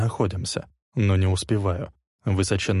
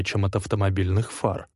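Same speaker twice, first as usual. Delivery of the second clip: plain reading voice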